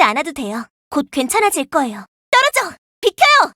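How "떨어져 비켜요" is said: high, loud shout to clear the way; urgent yelled warning. Acted. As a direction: bark them away from danger. Warning people off in combat.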